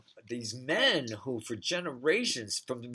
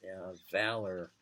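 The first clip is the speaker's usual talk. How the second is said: "valor" said softer, low and falling; savouring valor; 6 semitones lower, 6 dB quieter than usual. Shown as a demonstration. Relishing a wonderful word, "valor" murmured softly. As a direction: let "valor" melt away softly. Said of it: "that's a wonderful word".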